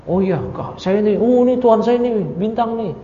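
teacher mocks the star worshippers, high sneering voice; mocking. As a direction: mimic a gullible star-worshipper to ridicule him. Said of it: "kayak lagi mencemooh".

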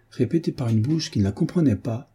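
plain reading voice

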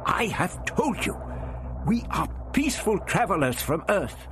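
high, earnest insistence; insistent old man. A narrator's reading. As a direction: repeat it patiently but firmly. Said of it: "answering vehemently".

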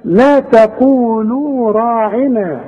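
لا تقولوا راعنا recited slowly with the ا of راعنا drawn out; slow recitation. Shown as a demonstration. Teacher recites the verse slowly for the class. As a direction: chant it slowly, letting راعنا stretch. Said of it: "تقولوا رقق".

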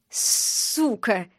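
put-on a mild drunken laugh on the word; lightly laughing drunk insult; laughing curse. A narrator's reading. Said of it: "рассмеялся".

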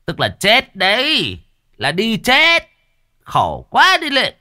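exasperated master losing patience, loud and high; exasperated anger. A narrator's reading. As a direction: spell it out in furious disbelief. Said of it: "Thấy cậu tức".

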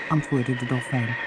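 ordinary delivery

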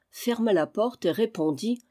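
ordinary delivery, nothing marked

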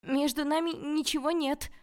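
high, loud, insistent denial; loud shouting. A narrator's reading. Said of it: "закричала я ещё громче".

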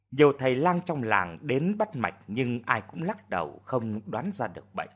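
ordinary delivery, nothing marked

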